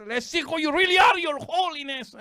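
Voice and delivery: high, excited cartoon reveal; Scooby-Doo unmasking. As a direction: announce the big unmasking, high and loud. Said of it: "the best Scooby-Doo episode ever".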